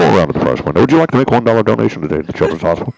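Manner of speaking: loud, fast drive-thru speaker voice; like a crackly drive-thru intercom. Retold or imitated. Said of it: "this is what they sound like".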